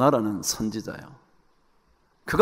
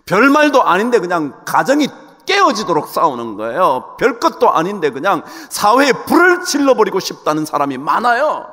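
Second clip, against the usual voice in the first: raised, emphatic preacher's voice; forceful preaching; 7 semitones higher, 10 dB louder than usual. Acted. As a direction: preach it high and urgent.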